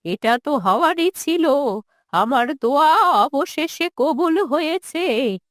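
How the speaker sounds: put-on quavering old mother, bitterly rejecting her son; wounded, resentful old woman. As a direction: refuse to hear of him, words drawn out.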